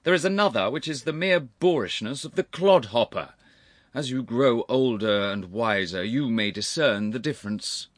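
stern, loud lecture to a youngster; severe. A narrator's reading. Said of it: "said Sir Charles severely".

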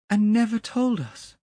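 very high, loud reproach; aghast accusation. A narrator's reading. Young man reproaches the clerk for silence, high and distraught. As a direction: rise from hush to a cry.

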